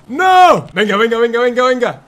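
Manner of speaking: loud, high, urgent cries; panicked shouting. Real, not acted. Player urging himself mid-race, panicked shouting. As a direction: yell it in a rush of urgency.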